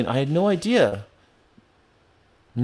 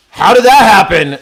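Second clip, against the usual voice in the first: loud, high mock-astonished outburst; mock shock; 6 semitones higher, 8 dB louder than usual. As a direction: burst out in pretend disbelief.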